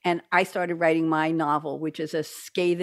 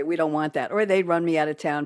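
usual speaking voice

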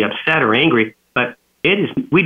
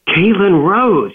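slow, higher, louder full-name warning; escalating stern tone; 6 semitones higher, 3 dB louder, much slower than usual. Retold or imitated. Dad escalating at daughter climbing the ladder, slow stern full name. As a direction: draw the full name out like a scolding. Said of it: "you add the middle name and you're really escalating".

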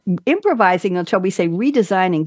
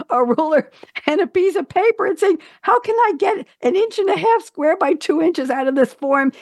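puzzled musing; 10 semitones higher than usual. Retold or imitated. Retelling his own puzzled musing.

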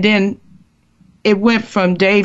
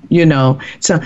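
ordinary talk, nothing marked

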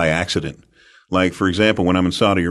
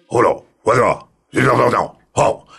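lightly put-on Japanese accent, bobbing hellos; a hint of a Japanese accent; mock Japanese accent. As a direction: imitate how he sounds in Japan, lightly. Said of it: "but I speak like Japanese".

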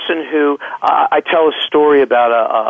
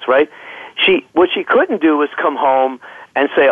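normal talking voice

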